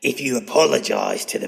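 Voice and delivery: measured creepy demand; villain voice. Acted. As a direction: intone it like a film monster.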